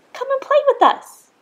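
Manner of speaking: very high, loud playful call. A narrator's reading. Other fish inviting Rainbow Fish to play, high loud call. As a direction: sing it out to a friend.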